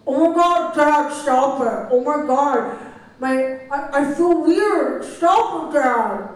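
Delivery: put-on high, loud exasperated kid; rolling eyes. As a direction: whine at dad, eyes rolling. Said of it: "we will play that for you now".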